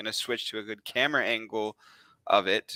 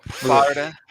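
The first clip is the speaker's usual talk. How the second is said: New York accent; 4 semitones higher, 6 dB louder, slower than usual. Shown as a demonstration. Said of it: "with my New York accent".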